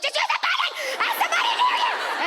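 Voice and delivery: shrieking high at mom, laughing; exasperated shout. Retold or imitated. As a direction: yell at mom on FaceTime, voice cracking high. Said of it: "You're like".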